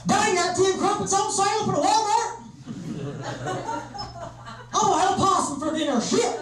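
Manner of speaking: put-on high, hollering American backwoods accent; hillbilly accent. Northerner as Londoners hear him, in a hammed-up hillbilly accent. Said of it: "all someone from London hears is".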